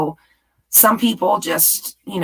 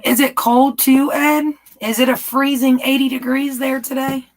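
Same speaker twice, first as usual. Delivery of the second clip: teasing; 7 semitones higher, 3 dB louder than usual. Real, not acted. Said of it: "I mess with Ed".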